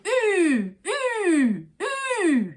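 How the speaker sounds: high, falling drawn-out "ooh" calls; urging on a horse. Shown as a demonstration. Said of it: "on a horse and you wanted".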